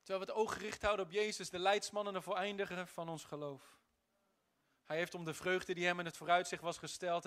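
ordinary talk, nothing marked

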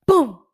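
loud, sudden "pum"; explosive boom. Shown as a demonstration. Warning that diabetes suddenly arrives, explosive boom. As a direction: punch it out like a bang.

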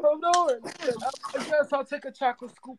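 mock tearful. Mock tearful self-talk, high.

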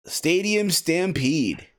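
slow, drawn-out match billing; announcer-style title. Pretend. Podcast host introducing the main event, slow announcer-style.

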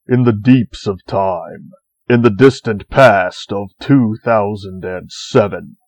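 hammed-up epic narration, long long ago; epic fantasy narrator. Mock epic intro narration.

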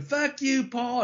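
high put-on voice for a crowd heckle; imitating Vader's voice as a joke; mock Vader voice. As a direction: heckle Paul in a silly Vader voice. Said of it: "his Big Vader voice".

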